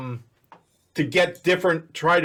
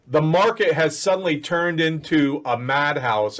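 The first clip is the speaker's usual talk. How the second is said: usual speaking voice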